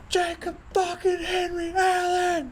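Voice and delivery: a high, drawn-out scream of a full name; furious shout. Retold or imitated. Angry coach screaming at his pole vaulter.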